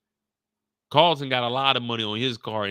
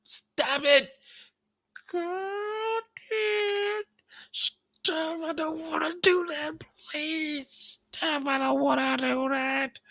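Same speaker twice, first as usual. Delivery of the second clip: very high pleading falsetto; Carlton pleading; slower than usual. Acted. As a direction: beg it to stop in a high squeak.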